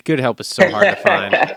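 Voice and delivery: loud mock despair at helpers; theatrical exasperation. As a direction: sigh it like a put-upon boss. Repeats louder, theatrical.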